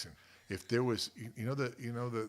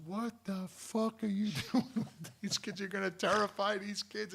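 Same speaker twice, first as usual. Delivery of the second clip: high-pitched imitation of a scolding wife; outraged wife; 11 semitones higher than usual. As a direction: scold him in a high, drawn-out mock voice. Angry wife acted out.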